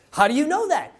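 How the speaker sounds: high-pitched, incredulous question; astonished. Acted. Mama questioning her little boy's claim, astonished. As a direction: jump up high in mock astonishment. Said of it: "a bit of astonishment in her face".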